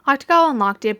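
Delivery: usual speaking voice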